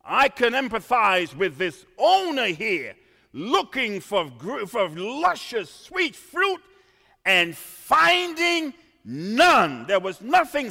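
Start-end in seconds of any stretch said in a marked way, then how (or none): none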